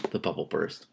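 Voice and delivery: ordinary delivery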